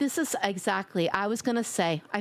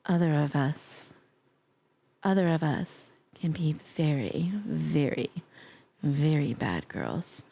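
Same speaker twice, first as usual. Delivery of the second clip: sultry tease; 8 semitones lower than usual. Pretend.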